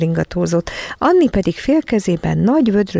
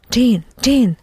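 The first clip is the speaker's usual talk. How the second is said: usual speaking voice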